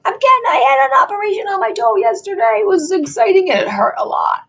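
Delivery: high, loud excited announcement; excited outburst. Real, not acted. Announces toe operation loudly.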